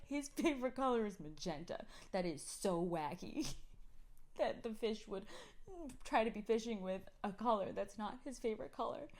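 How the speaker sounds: imitating child through laughter; laughing through kid quote. Mother quoting kids, laughing. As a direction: giggle while voicing the kid.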